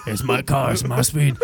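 put-on low elderly voice, unmoved by panic; old man. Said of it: "ninety fucking year old man".

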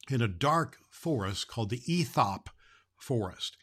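plain reading voice

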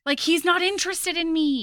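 high-pitched mock dismay; bewildered. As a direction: act out her bewildered distress. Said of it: "she's bewildered".

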